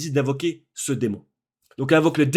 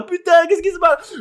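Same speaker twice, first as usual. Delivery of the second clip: much higher, louder astonished cry; hyped-up shock at the screen; excited disbelief; 13 semitones higher, 4 dB louder than usual. Retold or imitated. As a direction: gasp it out as if watching live. Reliving the climax of the film.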